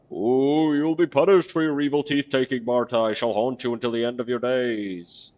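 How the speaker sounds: high, loud spooky corpse voice; ghostly threat. Acted. The corpse threatening to haunt Marta in a loud spooky wail.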